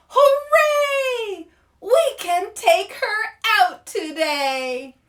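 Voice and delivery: loud, high cheering shout; yelled hooray. Acted. Students cheering when the teacher oversleeps, loud yell. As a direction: yell it like kids set free. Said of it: "all his students yelled".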